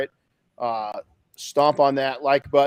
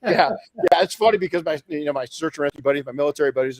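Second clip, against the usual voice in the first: ordinary talk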